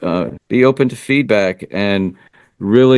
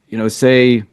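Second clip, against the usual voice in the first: normal talking voice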